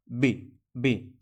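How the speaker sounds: "বে" pushed out very slowly and firmly; pushed syllable. Shown as a demonstration. Teacher shows students "বে" with a push, slowly. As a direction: give "বে" a little shove, drawn out. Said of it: "একটু ধাক্কা দিতে হবে".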